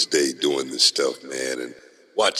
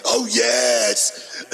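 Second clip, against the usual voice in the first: louder, higher whoop with a laugh; excited shout; 7 semitones higher, 3 dB louder than usual. Acted. Host greeting cohost, laughing shout.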